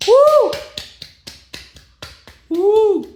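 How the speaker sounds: high, loud whoop; excited whoop. Acted. Family whooping at Michael's singing, high and loud. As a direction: whoop like a thrilled fan.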